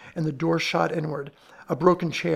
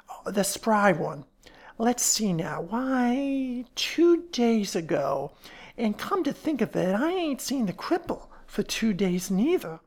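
higher-pitched, folksy character voice; fat woman, pondering; 5 semitones higher, slower than usual. Acted. Fat woman recalling when she saw the sisters, pondering. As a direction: think aloud, stretching words as they come.

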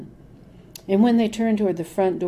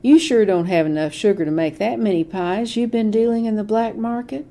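loud, jeering accusation with a cackle in it; cackling taunt; 5 semitones higher, 7 dB louder than usual. A narrator's reading. Deputy to grandmother at the register, loud jeering cackle. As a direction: needle her like a smug bully. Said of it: "Mister Hudson cackled".

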